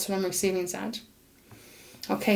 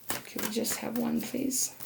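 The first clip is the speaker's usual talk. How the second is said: softer, gently pleading please; 10 dB quieter than usual. Real, not acted. Reader softly begs Spirit for just one card. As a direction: coax it quietly.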